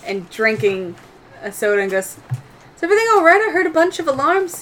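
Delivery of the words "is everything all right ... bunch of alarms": high, concerned question; Olia. Acted. Game master's entertainer coming out of the kitchen, high-voiced concern. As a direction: pitch it well up and a bit louder.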